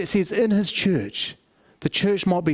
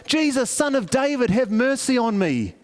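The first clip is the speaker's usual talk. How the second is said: desperate cry, pitched much higher; yelling out; 8 semitones higher than usual. Retold or imitated. Blind beggar yelling to Jesus in the crowd, desperate cry. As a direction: cry out for mercy over the crowd. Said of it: "he yells out".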